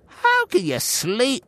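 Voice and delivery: high, astonished outburst at a sleeping man; exclaimed. A narrator's reading. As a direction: exclaim in disbelief, pitched high. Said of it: "the captain exclaimed".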